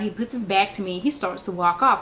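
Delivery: ordinary talk, nothing marked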